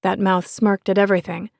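ordinary delivery, nothing marked

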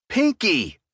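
sharp high bark of Pinky; barking an order at the bartender. Acted. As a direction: snap the name Pinky at him, high. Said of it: "I barked at him".

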